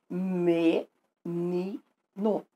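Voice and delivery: low, separate syllables with pauses between. Shown as a demonstration. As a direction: sound out "Me, ni, no" one syllable at a time. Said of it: "Porque a me é uma sílaba". (sílaba por sílaba, pausadamente)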